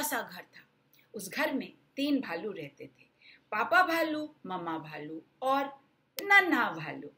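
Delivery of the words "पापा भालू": usual speaking voice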